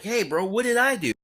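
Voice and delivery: high-pitched baffled protest; mock wounded objection; protesting innocence. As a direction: plead your case, voice lifted high. Retelling his protest at being blamed.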